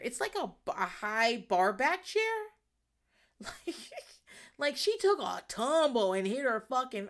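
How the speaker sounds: ordinary talk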